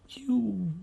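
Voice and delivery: ordinary delivery, nothing marked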